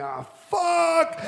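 sudden high yelp of alarm; startled yelp. Retold or imitated. Surfer retelling seeing a head pop up in the barrel, startled yelp. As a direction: yelp it, caught off guard. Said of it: "I'm going".

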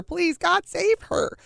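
beg, voice climbing and cracking upward; a father crying out to God for his daughter; frantic prayer. Retold or imitated. Father praying in panic, retold by mother.

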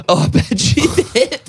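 high, gleeful retort broken by laughter; laughing innuendo. Retold or imitated. Him answering the sister's remark about Calissa, laughing suggestively. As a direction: crack up through the retort, pitched high.

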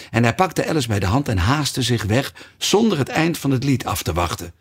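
plain reading voice

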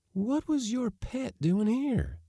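high, slow woman's questioning; Josefa's last stand. A narrator's reading. Josefa making a last stand, doubtful. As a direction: draw it out high, still doubting him. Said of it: "making a last stand".

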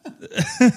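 high embarrassed giggle. Real, not acted. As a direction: let a squeaky giggle out. Laughing at own slip.

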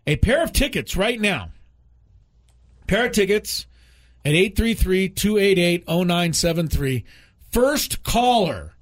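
radio contest promo. Acted. Radio ticket giveaway read.

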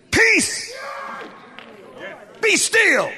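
high, ringing shouted order; forceful command with a pause between orders. Shown as a demonstration. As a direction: shout it with full authority. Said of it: "You gotta use your command voice".

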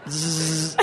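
imitated bacon sizzle; sizzling sound. Storyteller mimics bacon on a stove, sizzling noise. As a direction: buzz out a drawn sizzle. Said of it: "I hear something sizzling".

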